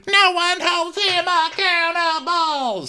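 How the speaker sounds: high, slow, shrill-pitched mock rant; mock screaming. Podcaster imitating Taylor ranting, slow high mock yell. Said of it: "going off screaming".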